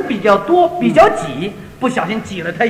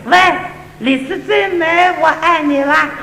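Cantonese woman; 4 semitones higher, slower than usual. Retold or imitated. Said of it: "我给你学学".

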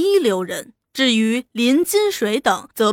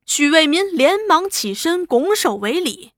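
ordinary delivery

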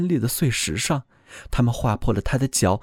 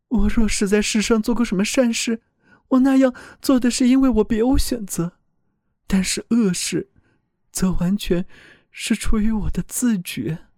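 higher, earnest voice of a frightened soul; pleading soul voice; 8 semitones higher than usual. A narrator's reading. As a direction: speak up as a soul defending itself. Soul confesses at heaven.

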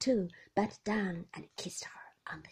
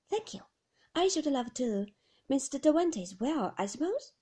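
high, quick, chirpy; bright cheerful nurse; 4 semitones higher than usual. Acted. The nurse answers Mrs de Winter, high and chirpy. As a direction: bubble along brightly. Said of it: "very bright, very cheerful".

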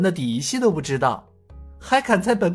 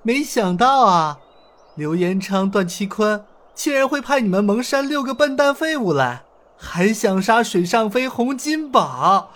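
faint mocking laugh; 11 semitones higher than usual. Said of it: "尹建平淡淡地笑道".

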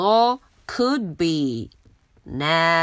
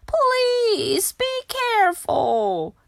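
much higher, slow pleading; worried plea; slower than usual. Acted. Younger sister begging her sister to be careful. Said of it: "Baby Lincoln说话了".

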